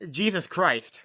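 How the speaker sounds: ordinary talk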